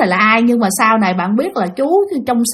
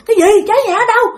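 high, urgent questioning; alarmed surprise; 10 semitones higher, much faster than usual. Retold or imitated. Neighbours rushing over with water, alarmed surprise. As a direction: imitate startled neighbours calling out.

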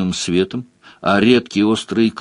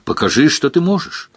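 shouting; 6 semitones higher than usual. A narrator's reading. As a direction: call out a dare to the demon. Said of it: "Леверлин тем временем крикнул".

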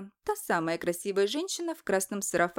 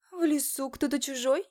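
higher, suspicious witch woman; a sly forest witch asking; witchy woman voice; 5 semitones higher than usual. Acted. Woman in red sarafan speaks.

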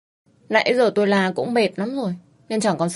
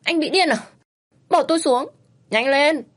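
shrill angry yell, much higher; 8 semitones higher, 3 dB louder than usual. A narrator's reading. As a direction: scream at him to put her down. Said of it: "tôi hét lên". (hét lên giận dữ)

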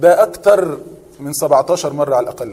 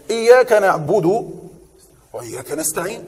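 slow, drawn-out recitation of the verse; Quran recitation; slower than usual. Shown as a demonstration. Teacher reciting a verse for the class, slow and drawn out. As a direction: stretch each word like a reciter.

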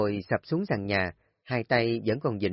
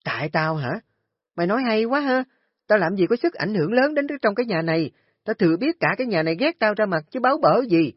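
shrill shouting retort; 10 semitones higher, 6 dB louder, faster than usual. Acted. Kim screams back at Nga.

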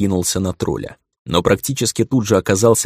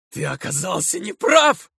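much higher, shrieking shout; shout breaking into a shriek. A narrator's reading. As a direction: scream it, voice cracking upward. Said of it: "Срываясь на визг, крикнул я". (крик, срывающийся на визг)

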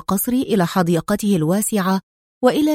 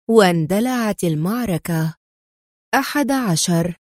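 ordinary delivery

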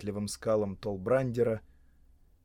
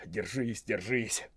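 quietly muttered self-encouragement; muttering; 2 semitones lower, 7 dB quieter, slower than usual. A narrator's reading. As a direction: mumble it under your breath. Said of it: "забормотал он".

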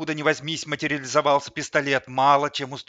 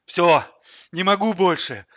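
loud, high exhausted outburst; giving up; 6 semitones higher, 9 dB louder than usual. A narrator's reading. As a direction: cry out that you're spent. Exhausted dancer collapses, giving up. (в изнеможении, с надрывом)

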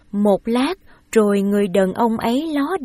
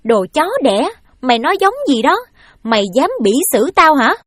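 loud angry scolding, higher; 5 semitones higher, 6 dB louder, faster than usual. A narrator's reading. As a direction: lash out at the boy. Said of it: "mắng rằng". (mắng to, giận dữ)

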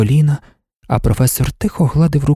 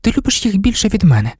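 put-on mildly reproachful girl, pitched high; a touch of girlish reproach; 8 semitones higher, faster than usual. A narrator's reading. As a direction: lift the pitch in light reproach. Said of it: "мовила дівчина з докором".

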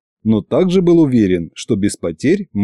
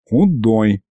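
usual speaking voice

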